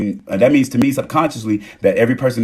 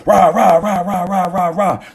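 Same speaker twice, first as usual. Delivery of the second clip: playful parody of a rant; mock ranting; 8 semitones higher, slower than usual. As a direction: babble like someone going off. Mocking someone ranting.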